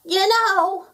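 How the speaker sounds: high, loud otter yell. A narrator's reading. Otter sharing an idea with gnome, yelling. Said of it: "yelled the otter".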